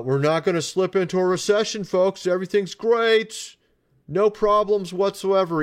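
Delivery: high-pitched sarcastic parroting; mock reassurance. Vlogger imitating the news story to viewers, mocking reassurance. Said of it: "saying in the story that".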